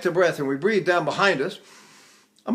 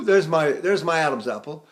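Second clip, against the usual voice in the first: ordinary delivery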